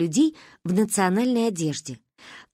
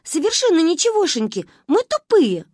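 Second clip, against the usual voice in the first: high loud cheerful confession; gleeful; 9 semitones higher, 5 dB louder than usual. Acted. Girls cheerfully admit they're dumb. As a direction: declare it proudly, grinning. Kids cheerfully self-deprecate.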